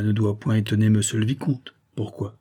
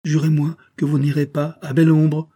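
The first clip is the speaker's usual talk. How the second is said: put-on high, loud, resolute boy; raised, determined young voice; firm demand; 6 semitones higher, 5 dB louder than usual. A narrator's reading. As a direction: insist on an oath, unyielding. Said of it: "répliqua l'enfant avec fermeté".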